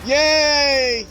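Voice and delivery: ordinary talk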